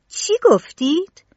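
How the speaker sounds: high, loud, slow disgusted question; hateful. A narrator's reading. Tistou reacting with hatred to Tronadis, high and loud. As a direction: spit it out in disbelief. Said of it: "با نفرت گفت".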